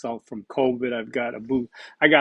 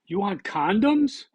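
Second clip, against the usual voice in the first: surprised mishearing; 7 semitones higher, slower than usual. Retold or imitated. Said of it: "And she said".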